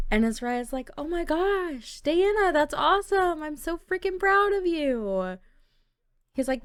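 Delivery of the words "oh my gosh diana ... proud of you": thrilled. Acted. Azariah hearing Diana's news, high and delighted. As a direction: cheer her on like a proud brother.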